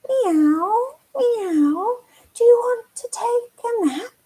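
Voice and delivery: high mewing cat voice. Acted. Cat inviting the spider for a nap, high and mewing. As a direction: meow up high, like a sleepy kitten. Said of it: "What does the cat say?".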